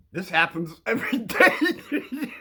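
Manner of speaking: fail to keep a straight face; cracking up. Real, not acted. Telling a kid this happens every day, cracking up. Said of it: "trying to keep a straight face".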